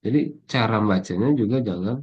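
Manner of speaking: ordinary delivery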